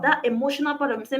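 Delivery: usual speaking voice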